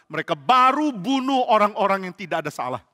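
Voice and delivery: plain reading voice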